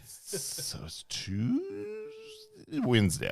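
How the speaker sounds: very slow, drawn-out, high-pitched guess; baffled guessing. Retold or imitated. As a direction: drag it out as if working out the day. Acting out not knowing the day.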